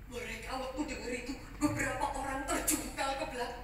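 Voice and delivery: ordinary delivery, nothing marked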